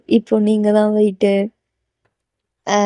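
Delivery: ordinary delivery, nothing marked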